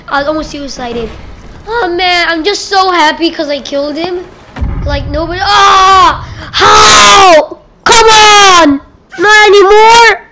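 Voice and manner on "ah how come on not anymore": loud, high cry of protest; real outraged gamer yell; frustrated shout. Real, not acted. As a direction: yell out in dismay at being killed. Killed in game, protesting loudly.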